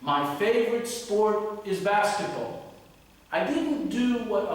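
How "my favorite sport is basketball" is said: firm, deliberate, higher and louder delivery; direct answer. Shown as a demonstration. Teacher models a straight exam answer, direct. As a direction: state it directly, slowly and emphatically. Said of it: "notice how direct I was".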